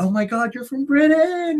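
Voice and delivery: imitating starstruck girls, rising very high; mock gushing, stretched out at the end. Retelling girls meeting a British guy, mock gushing.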